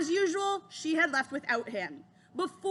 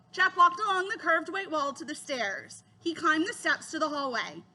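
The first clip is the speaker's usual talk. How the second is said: plain reading voice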